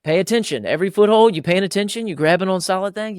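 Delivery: high, loud call up into the tree; calling up to son. Retold or imitated. Dad calling up to his son climbing a tree, high and loud. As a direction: project up to a boy high in the branches.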